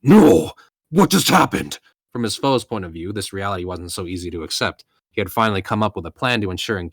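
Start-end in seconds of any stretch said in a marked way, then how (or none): 0.0-1.8 loud, high cry of disbelief; shocked outcry. Acted. Villain whose sure kill just failed, shocked outcry. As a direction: cry out in stunned protest.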